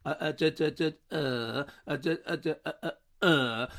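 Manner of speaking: mock stammering duh-duh sounds; a hint of mimicking her faltering speech; halting stammer. Mocking how the prime minister talks, with a light halting stammer. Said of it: "the way she talks, it's kind of quite odd".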